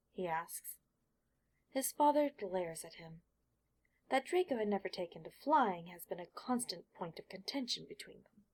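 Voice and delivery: usual speaking voice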